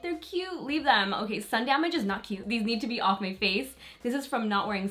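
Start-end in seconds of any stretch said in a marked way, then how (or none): 0.0-1.1 high-pitched mimicry of well-meaning people; imitated other people. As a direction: put on a higher voice to quote others. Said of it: "some people are like".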